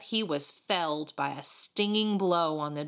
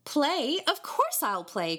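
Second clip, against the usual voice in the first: eager, high-pitched opening question; glad, willing agreement; joyous cry; 8 semitones higher, slower than usual. A narrator's reading. David happy to be asked to play, joyously. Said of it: "cried David joyously".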